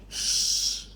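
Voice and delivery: soft long shh to hush someone; shushing. Shown as a demonstration. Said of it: "like you're shushing".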